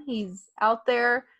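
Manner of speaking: ordinary delivery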